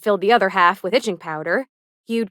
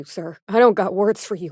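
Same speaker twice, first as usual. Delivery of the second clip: normal talking voice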